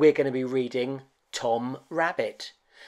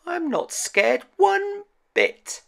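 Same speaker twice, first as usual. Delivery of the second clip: very high, slow squeaky toy voice; a tiny rabbit faking bravery; Tom Rabbit bravely; slower than usual. Acted. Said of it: "thought Tom Rabbit".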